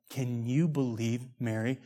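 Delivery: very soft, low confidential aside; mock whisper. Martha gossiping into Jesus' ear, hushed. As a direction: murmur it as if into someone's ear. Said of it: "not like a, like a whisper in the ear".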